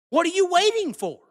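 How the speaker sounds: high, loud yell at the critics; real frustration boiling over; angry shout. Real, not acted.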